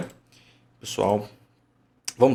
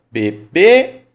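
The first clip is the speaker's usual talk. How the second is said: "Bebê" called louder, rising high on the final ê; prolonged final syllable; 8 semitones higher, 12 dB louder than usual. Shown as a demonstration. Teacher shows the stronger E in "Bebê", last syllable called out long. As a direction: hit and stretch the last ê of "Bebê". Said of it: "Onde ficou o prolongamento".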